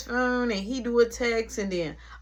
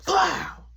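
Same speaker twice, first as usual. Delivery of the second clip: high-pitched slam noise; imitated card slam; 10 semitones higher than usual. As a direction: mimic cards smacking down in one burst. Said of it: "slammed the cards down was like".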